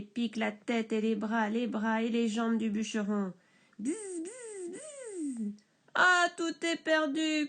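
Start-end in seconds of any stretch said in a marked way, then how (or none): 3.8-5.5 bzz aigu et doux; 1 bourdonnement aigu. A narrator's reading. Abeilles imitées. 5.9-7.5 crié lentement, aigu et fort; cri désespéré. Acted. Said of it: "crie le bûcheron".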